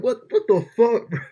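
high, loud, drawn-out disbelief; retold shock. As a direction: replay a stunned outburst, slow and loud. Acting own shock at driver's order.

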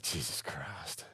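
slow, low, weary mutter; exasperated mutter. Retold or imitated. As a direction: let it sink out, drawn and quiet. Reacting to a bogus call.

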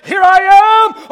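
slow, high, booming entrance; self-centered announcement. Acted. Self-important person announcing to the room, loud boast. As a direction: blare it out, drawn out and high. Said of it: "walk into a room and say".

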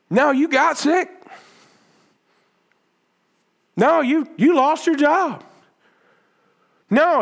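high, insistent preacher's hammer; preaching at full force. Acted. Pastor confronting the congregation with hard truths, insistent preaching. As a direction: drive each "No" home to the pews.

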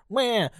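high mock baby cry "Wah"; baby wail. Imitating the baby Tommy finds in the bathroom, a high wail. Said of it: "we hear".

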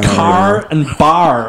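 slow car bar. Shown as a demonstration. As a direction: stretch out car and bar carefully. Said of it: "practising R sound".

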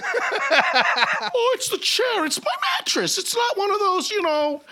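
high mocking excuse voice; mocking excuse-maker. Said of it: "That's what every fat person says".

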